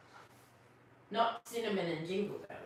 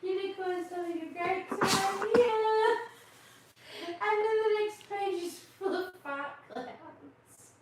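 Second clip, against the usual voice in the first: high, loud, delighted chatter; excited gushing; 10 semitones higher, 5 dB louder than usual. Real, not acted. Showing a funny picture book to kids, high excited gushing.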